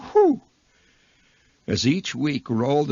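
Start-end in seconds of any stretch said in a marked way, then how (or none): 0.1-0.4 high, loud whistled exhale; awed whew. Acted. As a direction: let out an amazed high "whew". Reaction to the huge jackpot.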